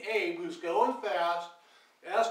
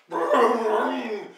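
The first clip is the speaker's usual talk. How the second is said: loud, revving vroom; motorcycle engine noise; 3 semitones higher, 10 dB louder than usual. Retold or imitated. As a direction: imitate a motorcycle roaring closer. Imitates an approaching motorcycle.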